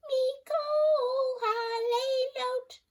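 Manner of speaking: very high slow squeaky echo; parrot squeak. A narrator's reading. Parrot echoing the girl, squeaky. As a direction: squeak it right back. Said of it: "The parrot squeaked right after me".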